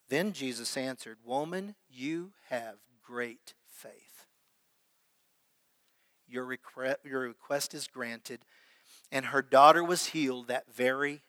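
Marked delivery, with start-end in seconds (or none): 1.2-8.4 slow, soft, low and tender. Retold or imitated. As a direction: bestow the blessing gently. Jesus speaks gently to woman.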